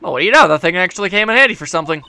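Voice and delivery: usual speaking voice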